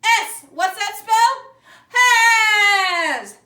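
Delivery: loud, high cheerleader chant; cheer. Shown as a demonstration. Teacher cheering out the letters to preschoolers. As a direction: spell it out like a pep rally. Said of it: "Now cheer it".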